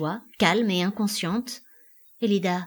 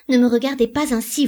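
loud, raised frightened protest; alarmed command; 5 semitones higher, 7 dB louder than usual. A narrator's reading. Elida covers her eyes before him, alarmed.

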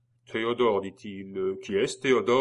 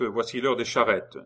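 plain reading voice

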